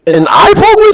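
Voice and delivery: high, disbelieving "eye poke?"; can't believe it; squeaky disbelief; incredulous. Real, not acted.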